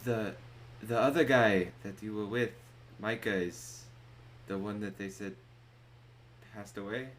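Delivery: halting, loud start that subsides; stunned innkeeper. Acted. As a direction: piece the bad news together haltingly. Game master as stunned innkeeper.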